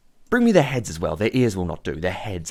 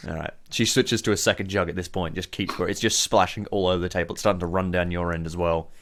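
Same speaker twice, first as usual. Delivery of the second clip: ordinary talk, nothing marked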